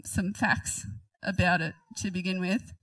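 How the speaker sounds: ordinary delivery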